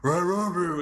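gruff mock barking; dog barking. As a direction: imitate a dog's ruff-ruff. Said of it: "So it's not just".

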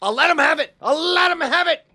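high, strained hostage-taker threat; threatening yell. Acted. Player demands to see the Emperor, threatening yell. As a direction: yell it as an ultimatum. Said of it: "I say, I swear to God".

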